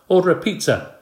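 high, loud call. A narrator's reading. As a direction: call it out across the room. Dad calls out order.

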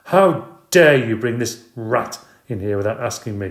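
loud, indignant scolding; wife scolding her husband over the pet; angry scolding. Acted. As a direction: tear into him for bringing it home.

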